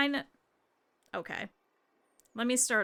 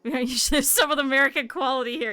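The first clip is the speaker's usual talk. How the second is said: laughing; 6 dB louder than usual. Real, not acted. Laughs while commenting.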